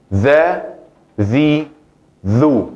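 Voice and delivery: Dha, zi, dhu said as clear syllable demos; pronunciación de la dhal. Shown as a demonstration. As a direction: sound out each syllable slowly. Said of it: "las pronunciaciones de la letra dhal". (sílabas pronunciadas con claridad)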